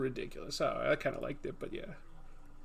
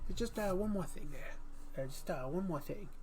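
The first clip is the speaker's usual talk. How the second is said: softer, hesitant detective afterthought; trailing one more thing, much softer; Columbo impression; 3 semitones higher, 7 dB quieter than usual.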